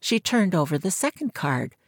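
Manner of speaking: ordinary delivery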